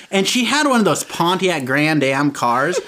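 ordinary talk

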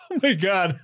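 ordinary delivery

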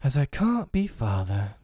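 put-on heavy-hearted child; mild, glum resignation; said heavily. A narrator's reading. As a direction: say it weighed down by disappointment. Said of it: "he said heavily".

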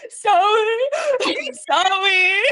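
very high, loud mock-toddler wail; crying child apologizing. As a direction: imitate a little kid sobbing out an apology. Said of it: "Scout started crying".

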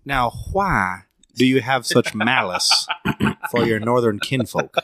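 slow, hammed-up old Southern accent; drawn-out Southern period voice; mock Southern drawl. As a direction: tease like a genteel antebellum Southerner. Mock Southerner teases about relatives.

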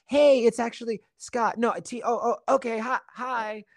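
high-pitched, faltering self-imitation; awkward correction. Newcomer correcting castmates about the name, high awkward giving in. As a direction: start to correct them, then give in, high.

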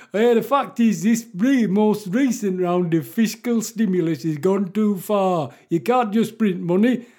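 loud, high mock Yorkshire accent; hammed-up Yorkshire pundit laying down the law; Geoffrey Boycott. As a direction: argue it in a broad put-on Yorkshire accent. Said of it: "what Geoffrey Boycott was born to talk like".

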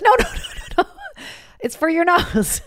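high laughing mock of the nurse, slowing on the last words; mock nurse correcting. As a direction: squeal no, no, then spell out the obvious. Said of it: "she's like".

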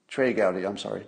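ordinary talk

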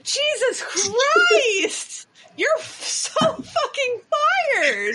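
high, drawn-out exasperated cry; mock outrage. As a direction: cry out in comic disbelief. Mock outrage at cohost's ignorance.

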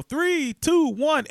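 high, loud counted-out cheer; New Year countdown. Retold or imitated. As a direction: count down loudly to midnight.

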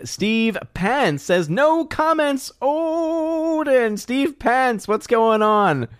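high, slow kitty voice; cat voice. Acted. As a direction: stretch it out like a cat. Cat voice again on a greeting.

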